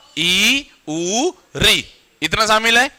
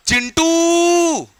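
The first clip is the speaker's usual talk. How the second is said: Chintu called out much higher, very slow, last vowel stretched; long drawn call; 10 semitones higher, much slower than usual. Shown as a demonstration. Calling a child named Chintu across the yard, high drawn-out call. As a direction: call a kid home from far away. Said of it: "जिसको आप लंबा खींचते हो".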